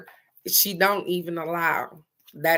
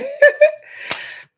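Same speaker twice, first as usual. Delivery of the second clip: shrieking happy laugh, much higher and louder; high delighted laugh; 9 dB louder than usual. Real, not acted. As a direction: burst out laughing with joy.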